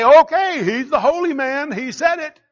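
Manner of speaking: high, loud mock-gullible agreement; hammed-up unquestioning follower; mock blind acceptance. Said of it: "You're not just supposed to say".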